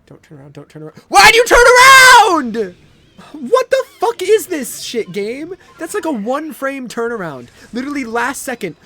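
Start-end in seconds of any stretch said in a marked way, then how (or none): 1.1-2.8 shrieking high and loud; screaming. Real, not acted. Enemy turns, gamer yells. 3.3-8.8 loud, high frustrated yelling; angry rant. Real, not acted. Raging at game.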